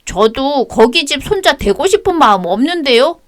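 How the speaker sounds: high, sulky schoolboy answering back; child's defiant voice. Acted. Grandson snapping at his grandmother, high child voice. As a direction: talk back like a stubborn kid.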